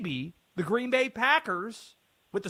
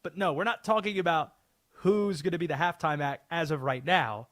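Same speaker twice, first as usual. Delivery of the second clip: ordinary talk, nothing marked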